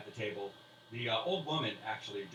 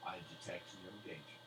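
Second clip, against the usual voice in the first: mystic verdict; 4 semitones lower, 10 dB quieter, faster than usual. Acted. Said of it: "she says".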